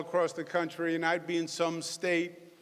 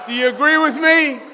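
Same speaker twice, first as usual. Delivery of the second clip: loud, higher call to the audience; 5 semitones higher, 12 dB louder than usual. Real, not acted. Governor asks the cheering crowd to agree, called out loud. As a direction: rouse the room with the question.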